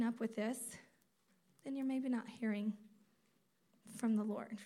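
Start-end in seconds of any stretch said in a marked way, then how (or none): none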